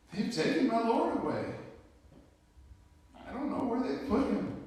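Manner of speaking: acted distress, pitched high. Acts out the weeping woman's answer.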